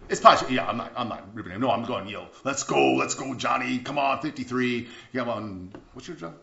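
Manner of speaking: usual speaking voice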